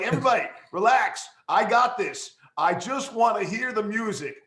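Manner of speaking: mock exasperation. Acted retort to impatient colleagues.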